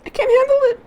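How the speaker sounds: very high falsetto whimper; whimpering girl falsetto. Acted. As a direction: wail it out, at wits' end. Girl gives up, crying.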